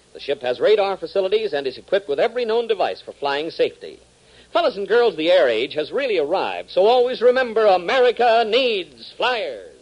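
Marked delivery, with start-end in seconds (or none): 4.5-9.7 pitched up, louder, slowing hard on the slogan; rally the kids to the cause; radio announcer driving home a slogan; rousing announcer plug. Acted.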